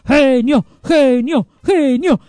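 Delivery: loud, high repeated cheer; crowd shouting. A narrator's reading. Circus audience hailing the magician, shouting. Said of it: "El público gritaba".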